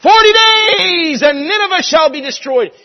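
high, slow thundering doom cry; shouting prophet Jonah. Retold or imitated. Jonah preaching doom to Nineveh, slow booming shout. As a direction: bellow the prophecy over the city.

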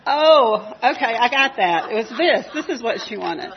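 loud, laughing oh of sudden understanding; laughing realization. Retold or imitated. Visitor realizing the girl meant candy, laughing loudly. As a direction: burst out laughing as it clicks.

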